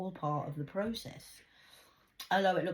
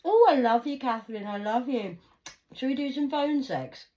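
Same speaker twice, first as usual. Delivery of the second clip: higher, louder gushing lover voice; mocking online suitor; 7 semitones higher, 9 dB louder than usual. Mocking men who wooed her online, gushing. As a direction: ham up a slimy online charmer.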